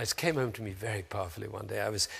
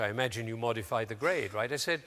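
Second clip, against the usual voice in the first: ordinary talk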